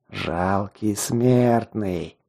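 slow, low, dead-toned demon lord; lifeless emotionless voice. Acted. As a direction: drawl it out, cold and utterly without feeling. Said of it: "Снова этот неживой голос, лишённый всяких эмоций".

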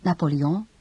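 normal talking voice

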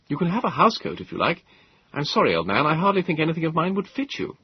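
a touch of wry modesty; young Moses. Acted. Moses voiced by narrator.

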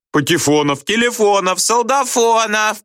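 very high pupils' voices, climbing; jeering correction. A narrator's reading. Pupils mock-correct the teacher's roll call, jeering. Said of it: "поправляли Слушкина".

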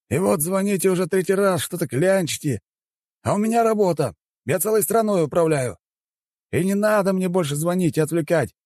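high-pitched, offended official; indignant chairman. Acted. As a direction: lecture the caller in high-voiced indignation. Chairman rebukes caller. (высокий возмущённый голос председателя)

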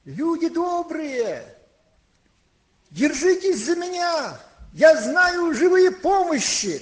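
high, drawn-out cry to a crowd; rallying shout to frightened people; loud calling out. Retold or imitated. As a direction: call out loudly and slowly, voice pitched high. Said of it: "громким голосом говорит".

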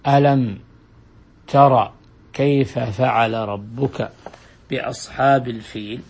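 slow, measured recitation of the verse; slow chanted verse; Quranic recitation. Shown as a demonstration. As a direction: intone it like Quran reading. Teacher recites Quran verse example.

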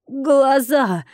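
mild acted squeal of alarm; startled shriek. A narrator's reading. Brothel owner spots eyes in the ground, mild shriek. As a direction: squeal out in sudden fright. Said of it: "ввизгнула дамочка".

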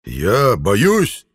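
loud outraged bellow; roar. A narrator's reading. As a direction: roar it in offended rage. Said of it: "Гигант взревел".

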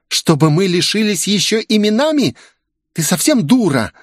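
high, irritated snapping outburst; snapping tantrum. A narrator's reading. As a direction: snap at the maid. Оливия срывается на служанку. (раздражённо, срываясь на крик)

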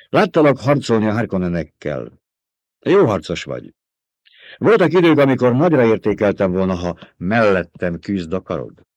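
scornful fighter rejecting a bribe; proud Fremen warrior. A narrator's reading. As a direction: Fremen warrior scoffing at being bought.

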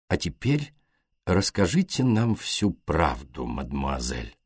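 put-on detective gently coaxing the young woman, soft and slow; gentle. A narrator's reading. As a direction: coax the truth out of her gently. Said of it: "мягко сказал Пуаро".

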